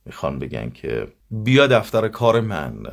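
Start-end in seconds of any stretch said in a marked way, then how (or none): 1.3-2.8 «بیا دفتر کار من» said crisp and loud, each vowel full; teacher models «بیا دفتر کار من» clearly, louder and higher; clear standard pronunciation. Shown as a demonstration. Teacher demonstrates clear diction for students. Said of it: "به همین شکل که من گفتم".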